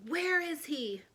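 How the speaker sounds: mother hunting the crocodile; yelling. A narrator's reading. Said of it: "yelled Mother".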